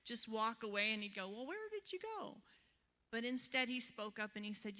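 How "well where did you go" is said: puzzled husband's question, pitched much higher and softer; imitating husband. Mimicking her husband wondering where she went, high and soft. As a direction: lightly mimic a baffled husband.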